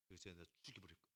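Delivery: murderous hiss. Retold or imitated. Preacher voicing the brothers' murderous jealousy, soft fast menace. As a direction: say it low like a secret grudge. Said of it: "이런 의미가 담겨져 있다".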